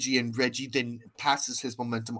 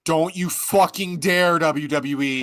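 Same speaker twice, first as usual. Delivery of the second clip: higher, louder, slow threat; mock warning; 6 semitones higher, 6 dB louder than usual. Reading her note to WWE aloud, stern mock threat. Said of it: "the note I have written".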